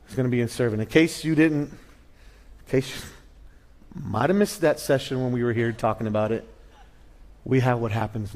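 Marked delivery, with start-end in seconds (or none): 1.7-3.0 breathy whispered aside, slowed right down. Real, not acted. Preacher whispering to the congregation about the missed session. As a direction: lean in and whisper it to the room.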